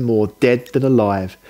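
plain reading voice

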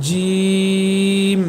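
জিম with a very long "ee" closing on m; mid-tongue to palate, sustained জিম; full letter sound. Shown as a demonstration. Teacher demonstrating the letter jim, long held জিম. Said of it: "এটা একটু মোটা হবে".

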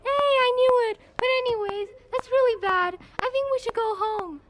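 squeaky classmate voice; high character voice. Acted. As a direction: pitch it up for a different character.

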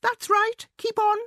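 loud, very high mouse shout. A narrator's reading. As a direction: shout commands in a piping mouse voice. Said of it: "and to shout".

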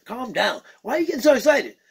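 normal talking voice

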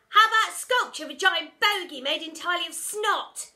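put-on loud high kid shouting from the back; cheeky shout. A narrator's reading. Naughty pupil heckling from the back, loud and cheeky. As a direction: heckle the teacher. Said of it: "shouted a voice from the back".

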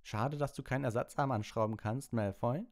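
high, cheeky comeback; lightly voiced cocky Harry; Harry taunting. A narrator's reading. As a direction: toss the jab back with a grin. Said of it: "sagte Harry".

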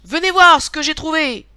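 high, loud summons; rally the whole family to look; excited call to the burrow. A narrator's reading. Rabbit rallies his burrow.

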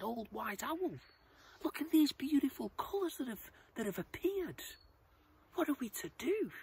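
high, loud little butterfly voice; small anxious butterfly. Acted. Butterfly asks the wise owl about colours, high and urgent.

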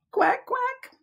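very high squeaky quacking; duck quack. Acted. Storytime for preschoolers, a very high squeaky duck quack.

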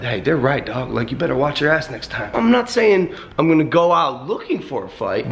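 ordinary talk, nothing marked

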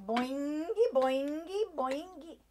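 silly clown. Acted. Bouncing car game.

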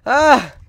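sudden loud burst of a yell; exploding yell. Acted. Gamer blowing up at a fact mid-run, a loud mock yell. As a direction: let it explode out of you, hammed up. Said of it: "that fact just hit me and I just had to, like, explode".

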